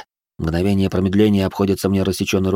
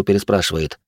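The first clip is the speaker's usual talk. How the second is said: ordinary delivery, nothing marked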